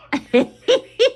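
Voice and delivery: real laugh bursting out high; can't hold in a high giggle; high burst of laughter. Real, not acted. Vlogger cracking up at her own craft, laughing high.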